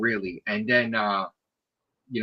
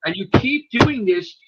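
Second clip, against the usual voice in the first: higher, emphatic telling-off; retold stern scolding; 5 semitones higher, 4 dB louder than usual. Officer scolding him for risking his life, stern berating. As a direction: drive each point home, raised voice.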